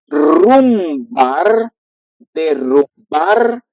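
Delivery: derretir drawn out slowly with a rolled R; slow trilled R. Shown as a demonstration. Teacher slowly modeling trilled R words. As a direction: roll the double R slowly in derrumbar. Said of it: "use the double R".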